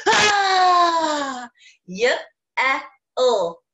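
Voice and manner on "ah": loud, held scream, falling away; demonstration yell. Shown as a demonstration. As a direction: let out a long raised "ah" yell. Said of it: "we're gonna have to yell".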